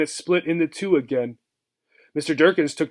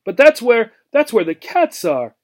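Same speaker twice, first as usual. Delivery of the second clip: high, stammering alarm; horrified; 7 semitones higher, 5 dB louder than usual. Acted. Gerbil Obie, horrified at going into the kitchen. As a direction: blurt it out in fright. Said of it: "She was horrified".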